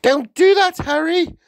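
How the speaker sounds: very high, loud protest; outraged high-pitched outcry; crying out. A narrator's reading. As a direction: wail it at the husband, high and loud. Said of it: "Missus Wormwood cried out".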